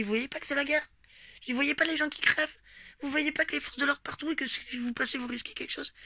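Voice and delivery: indignant retold scolding; getting angry. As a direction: retell how you tore into her, voice climbing. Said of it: "je commence à m'énerver".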